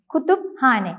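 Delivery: "കുത്തുബ് ഹാനെ" split as kutup-hane; correct Turkish pronunciation. Shown as a demonstration. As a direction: sound p and h separately in "കുത്തുബ് ഹാനെ". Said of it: "എന്നാണ് ഉച്ചരിക്കേണ്ടത്".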